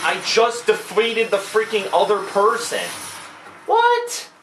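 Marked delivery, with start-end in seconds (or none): 3.7-4.3 very high squeaked what; incredulous high squeal; squeaky disbelief. Real, not acted. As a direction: shriek it in total disbelief. Boss heals again.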